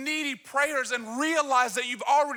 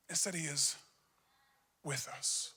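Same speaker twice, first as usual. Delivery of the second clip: hushed; 8 semitones lower, 12 dB quieter than usual. Real, not acted. Hushed climax.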